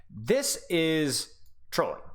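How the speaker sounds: very slow, stretched-out "This is trolling"; drawn-out. Acted. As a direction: drag out "This is trolling" in mock disbelief. Drawn-out mock disbelief at positioning.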